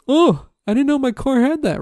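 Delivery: gasping at news about their own car; mock surprised owner. Mocking the owners at the reveal, high mock surprise. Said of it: "the people were like".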